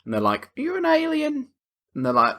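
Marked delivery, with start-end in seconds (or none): 0.6-1.5 much higher, sing-song question; mock interrogation. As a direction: ask it in a high comic voice. Acts out questioning a suspected alien.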